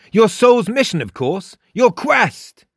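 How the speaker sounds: high, grand announcement; emphatic high character voice; announcing. A narrator's reading. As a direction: proclaim it as if obvious. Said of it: "announced Frost".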